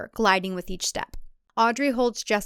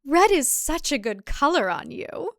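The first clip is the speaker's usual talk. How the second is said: plain reading voice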